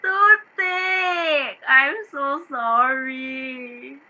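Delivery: slow, sobbing apology; tearful sorry to the maid; crying apology. Acted. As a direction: break down as you say sorry. Employer breaks into crying.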